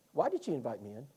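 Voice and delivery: ordinary talk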